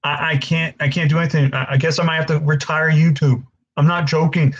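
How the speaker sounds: ordinary talk, nothing marked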